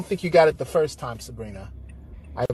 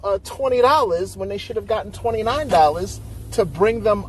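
excited; 7 semitones higher than usual. Real, not acted. Heated, high-pitched explaining.

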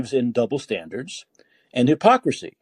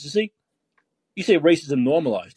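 normal talking voice

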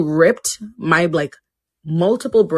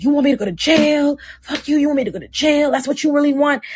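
imitating him, pitched way up and defensive; hammed-up accusing boyfriend, higher voice; mock angry boyfriend; 9 semitones higher than usual. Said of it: "he's like".

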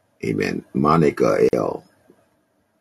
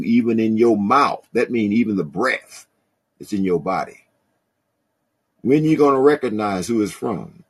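preaching; 8 semitones higher than usual. Acted. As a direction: preach it out with full fire. Preaching at full force.

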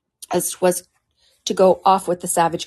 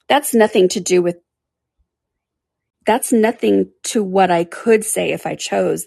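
pleading tone; 4 dB louder than usual. Acted. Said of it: "in a pleaded tone".

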